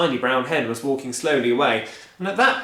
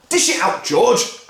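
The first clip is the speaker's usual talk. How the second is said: higher, loud, impatient; eager father; 10 semitones higher, 8 dB louder, slower than usual. Acted. Mister Cranky urging George, eager and loud. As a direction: urge George on. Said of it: "cried Mister Cranky".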